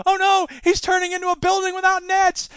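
high, loud panicked cartoon cry; cartoon hero panic. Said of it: "Transformers cartoon".

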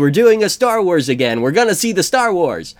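rally the whole theater, beaming; excited crowd. Retold or imitated. Voicing a cheering theater audience, giddy and loud. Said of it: "and it's like".

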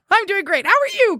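high-pitched mock eagerness; exclamation-point enthusiasm. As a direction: imitate an over-keen texter, pitch leaping up. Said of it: "exclamation point".

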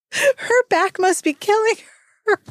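amused laughing. Real, not acted. Still laughing.

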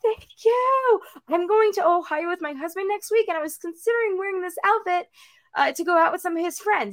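high, quick, sugary mock voice of herself; mock sweet voice. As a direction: play up a sweet chatty traveler, fast. Her own quote, putting on a voice.

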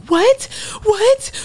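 very high, loud incredulous what; stunned disbelief. Retold or imitated. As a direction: shriek it as if you can't believe you won. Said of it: "I say".

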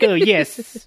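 meek little husband yessing a giant woman; tiny meek man voice. Retold or imitated. To a domineering cartoon woman, a lightly voiced meek little man. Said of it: "That's really good".

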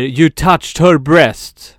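högljudd, utdragen anklagelse; härmad trucker. Retold or imitated. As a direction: imitera en anklagande trucker, långsamt. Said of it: "Och en av dem säger". (härmat anklagande utrop med utdragna ord)

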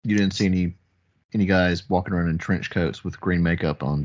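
ordinary talk

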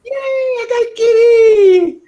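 very high, loud happy squeal; excited squeal. Real, not acted. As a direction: shriek with delight over the kitty. Catches the cat.

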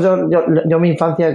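normal talking voice

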